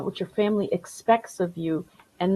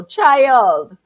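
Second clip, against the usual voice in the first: lightly voiced Caribbean grandpa; a hint of a scolding Caribbean grandpa; Caribbean accent; 4 semitones higher, 6 dB louder, slower than usual. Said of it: "I can do the strong Caribbean accent".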